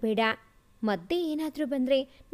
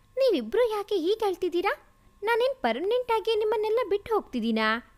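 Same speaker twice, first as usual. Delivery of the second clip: higher, fast, bright-eyed chiding of the mothers; cheerful teasing; 6 semitones higher, faster than usual. Acted. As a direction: laugh off their tears, quickly and higher. Son teases crying mothers before leaving.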